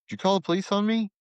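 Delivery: high, fast, alarmed question to his wife; frantic question. Retold or imitated. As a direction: rush it out in alarm.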